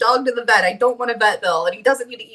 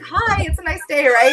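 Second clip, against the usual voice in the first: mock cheery, higher-pitched hello; fake-friendly greeting; 6 semitones higher than usual. Caught cursing, greeting the neighbour on his porch, fake-friendly. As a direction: put on the fakest neighbourly voice. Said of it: "it was, like, the fakest voice ever".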